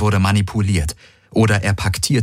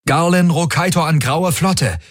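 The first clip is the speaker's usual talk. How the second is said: high, urgent radio hail; man hailing the fleet; 10 semitones higher than usual. A narrator's reading. Man calls the Grey Fleet over the comm, urgent. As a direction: hail the whole fleet, pitched up. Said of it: "drang seine Stimme aus den Klangprojektoren".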